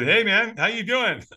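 lightly voiced friendly stranger; stranger's voice. Retold exchange with stranger.

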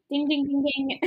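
game show bell ding; correct answer ding. Pretend. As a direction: chime it like a quiz bell. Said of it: "I like my button".

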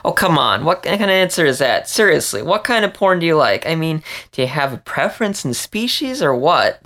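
put-on high, teasing mouse girl, pressing for an answer; giggly pushing. Emma nudging Vince to answer, giggly and teasing. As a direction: playfully insist with a giggle in the voice. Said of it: "Emma giggled".

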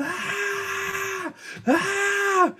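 dad imitating his son screaming in the parking lot; toddler screaming. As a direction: wail like a toddler throwing a fit. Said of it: "starts screaming bloody murder".

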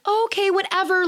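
high, breezy give-in voice; pushover shrug. Retold or imitated. Women caving to staff, high breezy okay-whatever. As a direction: toss it off like nothing matters. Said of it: "we're just so like".

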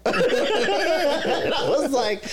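high drawn-out laugh through the words; can't stop laughing as you say it; laughing hard. Real, not acted. Telling friend about the car, laughing hard.

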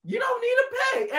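high, loud disbelief; baffled, indignant pitch; incredulous. Retold or imitated. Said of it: "And I was like".

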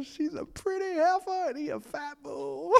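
high, tearful laughter; real laughter bubbling up; laughing. Real, not acted. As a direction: squeak it out while laughing. Laughing again recalling.

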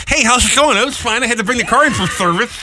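husband mimicking his wife's lisping voice; mock lisping voice. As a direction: tease her by lisping through a retainer. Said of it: "whole conversation doing the".

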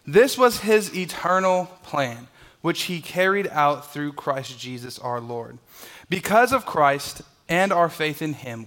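slow, measured Bible reading; scripture reading. Acted. Pastor reading Ephesians aloud to the church, slow and measured. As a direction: read the verse slowly and solemnly.

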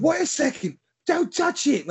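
Bob Hoskins impression. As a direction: warn him off like a London shopkeeper. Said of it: "sounds so much like Bob Hoskins".

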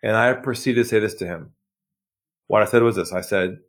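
ordinary delivery

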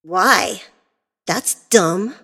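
a touch of whining; mildly bratty complaint; whiny. A narrator's reading. Spoiled daughter refusing her father, mildly whiny. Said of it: "Lily whined and complained".

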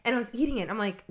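usual speaking voice

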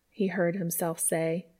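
ordinary delivery

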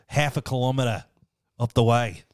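hammed-up Australian nature-show commentary; Steve Irwin. Host describes lanky friend in the wild, Australian accent. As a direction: point out the beast in a broad Australian accent. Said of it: "How would Steve Irwin describe".

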